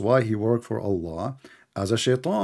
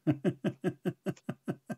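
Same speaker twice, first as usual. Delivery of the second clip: long soft chuckle; drawn-out run of chuckles; real laughter; 6 semitones higher, 11 dB quieter than usual. Real, not acted. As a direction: laugh quietly at the other side's argument. Laughs at opponent's argument.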